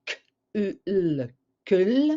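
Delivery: K, U, L as separate sounds, then joined into CUL; sounding out letters. Shown as a demonstration. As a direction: phonics decoding of "cul", sound by sound. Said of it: "Si je donne les sons".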